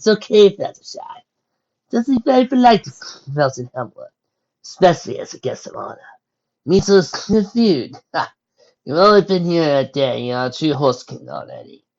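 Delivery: jolly, hearty and loud; amused, hearty. Acted. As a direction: laugh it off warmly. Laughing Freya reassures.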